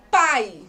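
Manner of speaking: "pai" with a loud A and a barely sounded I; stress on the A. Shown as a demonstration. Teacher showing pupils the ditongo, strong A in "pai". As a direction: lean hard on the A in "pai", letting the I fade. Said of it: "qual que eu falo com maior intensidade?".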